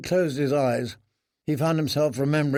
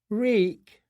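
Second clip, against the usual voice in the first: high, small echo of the name; broken prisoner; 8 semitones higher than usual. Acted. Prisoner repeating the name they gave him, high broken voice. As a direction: repeat the name like a lesson learnt.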